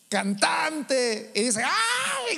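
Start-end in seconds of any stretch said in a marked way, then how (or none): 1.6-2.4 a sudden high mock squeal, far above the voice; imitating teenage girls shrieking over a singer; mock fangirl squeal. Preacher mocking girls screaming for a singer, high squeal. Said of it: "gritan por él".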